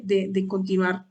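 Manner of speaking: ordinary delivery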